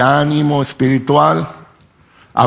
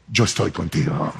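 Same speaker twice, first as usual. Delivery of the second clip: much softer and lower, gentle and close; soft reassurance; 5 semitones lower, 8 dB quieter than usual. Acted. Preacher voicing God softly comforting the frightened. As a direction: breathe it out as a quiet comfort. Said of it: "Y Dios nos repite".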